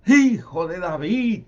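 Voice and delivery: long beseeching cry; straining to shout. Acted. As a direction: call out hard to someone walking away. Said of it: "Se esforzaban más gritando".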